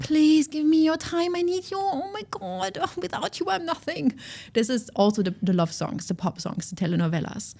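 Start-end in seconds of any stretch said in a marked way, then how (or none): 0.0-4.1 high, clingy, begging voice; a codependent lover begging not to be left; desperate pleading. Pretend. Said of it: "going down to boundaryless and in shame".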